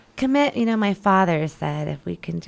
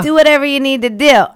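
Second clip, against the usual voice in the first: emphatic higher quote; 9 semitones higher, 12 dB louder, faster than usual. Retold or imitated. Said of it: "like Isla said".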